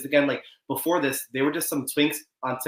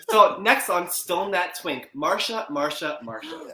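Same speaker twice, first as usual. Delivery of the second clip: show host intro; 4 semitones higher, slower than usual. Pretend. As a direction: tease the next segment like a host. Mock show segment intro.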